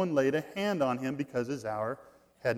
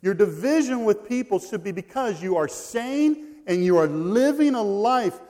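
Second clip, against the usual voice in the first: loud, high, driving sermon delivery; emphatic preaching; 4 semitones higher, 7 dB louder than usual. Real, not acted. Preacher urging the congregation about Jesus, loud and emphatic. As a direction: drive the point home at full force.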